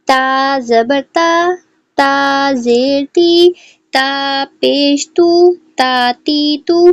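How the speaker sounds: slow, drawn-out syllable drill; slow drill reading. Shown as a demonstration. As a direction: read each sound slowly and clearly like a Qaida teacher. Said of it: "اس کو ہم ریڈ کریں گے".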